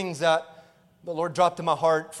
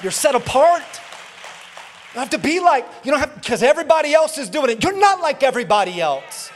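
raised, louder preaching voice; impassioned urging; 6 semitones higher, 6 dB louder than usual. Retold or imitated. Father tells son he is set apart, impassioned. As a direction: drive it home high and loud.

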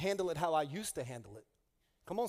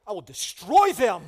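mock threat; 12 dB louder than usual. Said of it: "You're like".

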